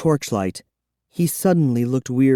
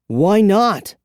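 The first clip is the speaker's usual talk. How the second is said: higher, louder demand; demanding boy; 7 semitones higher, 4 dB louder than usual. A narrator's reading. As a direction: snap the question as a kid. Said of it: "I demanded".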